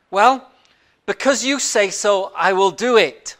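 polite reply that hints at unspoken doubts; polite but doubtful. Acted. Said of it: "Simon is very polite".

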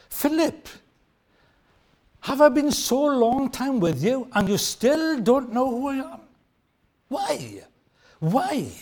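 sorrowful frustration at Philip; frustrated lament. Retold or imitated. Jesus asking Philip, frustrated then fading. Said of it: "bordered on frustration".

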